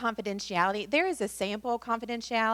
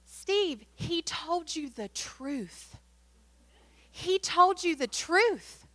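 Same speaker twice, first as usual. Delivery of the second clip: high, slow, earnest plea with a lift; wife urging her husband to see the good news; excited insistence; 9 semitones higher, much slower than usual. Retold or imitated. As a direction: press it on him, pitched high and drawn out. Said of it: "And Mary Beth said".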